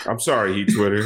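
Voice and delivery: low, mock-contrite apology; playful deep R&B talk-over. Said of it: "I'm about to do my deep voice".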